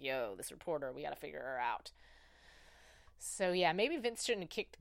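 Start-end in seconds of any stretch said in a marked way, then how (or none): 0.0-1.9 lightly voiced boss, low and quiet; boss dude impression. Head boss dude to his meeting, lightly voiced, low. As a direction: drop low and soft like a boss.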